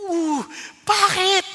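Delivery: loud, drawn-out, stunned "bakit"; shocked bakit. Retold or imitated. Stunned husband hears wife refuse the trip, loud, slow disbelief. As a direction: let the shock burst out, slow and high.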